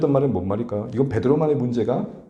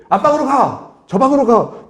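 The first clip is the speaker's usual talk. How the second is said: high, loud, firm order naming the room; clear firm command; 10 semitones higher, 3 dB louder than usual. Shown as a demonstration. Father showing how to scold the kids clearly, a high firm shout. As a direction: tell the kids exactly where to go, loud and high. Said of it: "분명히 얘기해 주세요".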